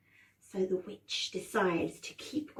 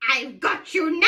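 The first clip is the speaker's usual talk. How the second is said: loud, higher gloating witch; 6 semitones higher, 5 dB louder than usual. Acted. Witch has caught Hansel and Gretel, gloating witch voice. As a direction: gloat over your catch like a witch. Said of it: "And this witch says".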